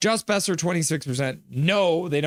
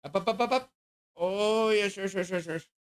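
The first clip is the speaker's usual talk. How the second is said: higher, softer sing-song coaxing; coaxing dog talk; 4 semitones higher, 4 dB quieter than usual. Real, not acted. Owner coaxing his dog Dobby up for a treat, higher and softer. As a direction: coax the dog up onto your lap.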